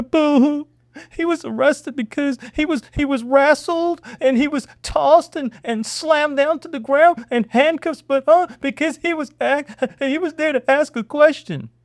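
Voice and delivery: mock his excuse with a laughing whine; sarcastic mock pity. Vlogger sneering at the senator's excuse, high sarcastic whine.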